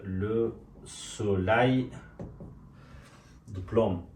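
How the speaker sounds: very slow, syllable by syllable; slow teaching repeat. Shown as a demonstration. Teacher drilling the French phrase slowly for students. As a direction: stretch each word out for learners.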